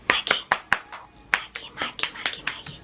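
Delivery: urgent whispered name-calling; hushed, voiceless acted wake-up call; waking someone up. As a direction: whisper it repeatedly to rouse a sleeper. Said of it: "they got me up".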